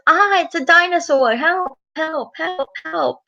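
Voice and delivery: high-pitched pretend cries for help; play-acted panic. Peppa's dolly flees the dinosaur, mock panic. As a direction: squeal it like a doll fleeing a monster.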